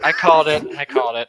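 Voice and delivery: smug triumphant repeat; gleeful gloating. Real, not acted. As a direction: crow about being right.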